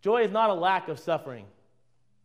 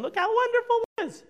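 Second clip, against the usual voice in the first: very high, gushing fake-smile voice; showy happiness. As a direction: gush it sky-high through a big smile. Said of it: "display this great idea of a big smile".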